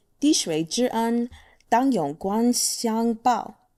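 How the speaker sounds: "滴水之恩， 当永光相报" said carefully with Mandarin tones; measured, careful "滴水之恩， 当永光相报"; Mandarin proverb. Shown as a demonstration. 慢慢地说中文成语. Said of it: "I'm going to say the expression".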